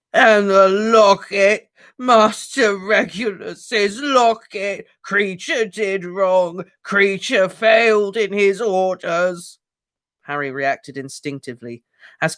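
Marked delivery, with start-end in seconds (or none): 0.1-9.6 high, loud, slow anguished shrieks; screaming elf. Acted. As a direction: wail it out in despair. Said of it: "uttered a blood-curdling scream".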